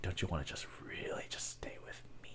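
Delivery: breathy sultry whisper; seductive whisper. Shown as a demonstration. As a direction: whisper it close to the mic, seductively. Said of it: "you just fucking whisper into the mic".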